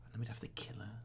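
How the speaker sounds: hushed whisper. Real, not acted. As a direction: breathe it quietly. Whispered joke.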